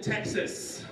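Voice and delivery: ordinary delivery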